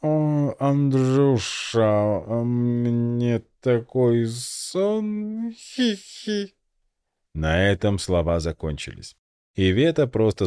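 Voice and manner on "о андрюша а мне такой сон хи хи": put-on high, slow, slurred drunken giggle; tipsy slurring, dragging each word. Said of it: "противно хихикая, ощупывая свой живот и еле ворочая языком".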